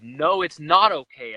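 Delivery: slow, loud snapping "No, it's not okay"; a loud, slow retold outburst; retold snap. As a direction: bite the words out slowly, fed up. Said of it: "I finally just snapped".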